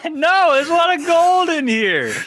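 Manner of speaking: high loud laughing protest. Real, not acted. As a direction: protest while still laughing. Defending silly notes, laughing.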